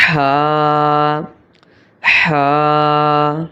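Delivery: ہا with a strong pharyngeal h, vowel held; repeat-after-me ہا, squeezed and drawn out; mid-throat ح. Shown as a demonstration. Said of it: "حلق کے درمیان والے حصے سے ادا ہوتا". (ہا حلق کے درمیان سے، کھینچ کر)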